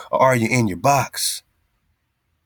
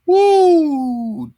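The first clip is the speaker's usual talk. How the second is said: sudden high whooping yell; 8 dB louder than usual. Acted. Host reacts to puppet, high loud whoop. Host's shrill exclamation.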